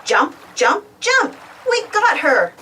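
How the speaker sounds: ordinary delivery, nothing marked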